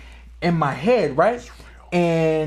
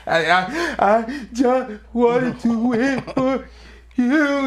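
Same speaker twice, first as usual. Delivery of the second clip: higher, slow and broken, near sobbing; retold sobbing plea; 10 semitones higher, 4 dB louder, much slower than usual. Son sobbing to his dad after losing a fight, slow and broken. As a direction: drag each word out through tears. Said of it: "frantically crying".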